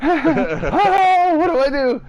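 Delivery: very high, loud helpless wail; mock panic. Stoned guest looks to friends for help, hammed-up panic.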